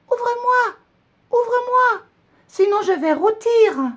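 very high, loud squeaky little voice; tiny calling voice. A narrator's reading. Gingerbread man calling from the oven, very high and loud. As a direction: call out like a tiny cookie trapped in the oven. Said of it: "une petite voix qui appelle".

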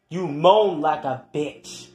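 ordinary talk

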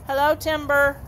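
normal talking voice

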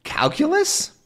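very high, rising disbelief; can't believe it's him; astonished. Acted. Haddock astonished at Calculus surfacing, disbelief.

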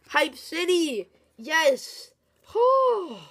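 high, loud, drawn-out cries of joy; over the moon about the card; ecstatic yelling. Real, not acted. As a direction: scream with delight. Pulls Aggron EX, celebrating.

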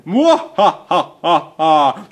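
slow rising maniacal cackle; hammy mad scientist laugh; evil laugh. Acted. Villain's evil laugh repeated.

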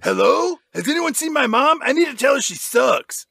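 high-pitched mock kid voice; Milo calling out. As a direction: call down an empty hallway for anyone. Playing Milo searching for mom.

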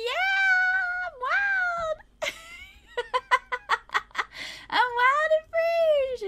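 put-on very high, drawn-out toddler cheer; hammed-up high wild-child voice; gleeful wild toddler. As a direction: shriek it like a toddler running free, breaking into giggles. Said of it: "She's like".

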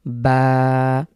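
বা held about a second from relaxed lips; বা with lips resting, drawn for one beat; demo of ba. Shown as a demonstration. Arabic tutor demonstrating বা with a one-second hold. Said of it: "যেভাবে রয়েছে ঠিক সেভাবে আমরা উচ্চারণ করব".

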